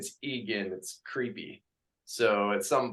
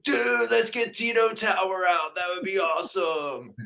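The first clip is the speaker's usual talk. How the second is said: quoted friend; 7 semitones higher than usual. Retold or imitated. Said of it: "He's like".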